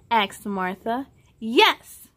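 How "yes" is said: louder eager yes; shouting yes. Acted. The friends answering Marta, an eager shout. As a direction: shout it together. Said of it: "they all shouted".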